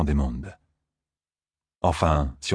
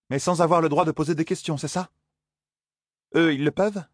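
put-on higher, questioning young woman; Zarine, wary young woman; 9 semitones higher than usual. Zarine bargaining with Moiraine, higher and suspicious. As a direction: probe the terms, suspicious and pointed.

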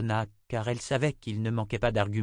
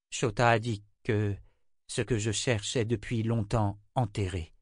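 ordinary delivery, nothing marked

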